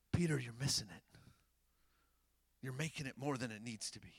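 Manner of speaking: low, soft aside, half-whispered at first; hushed undertone. Retold or imitated. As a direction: let it drop to a quiet, imagined reproach. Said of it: "I feel this undertone in being like".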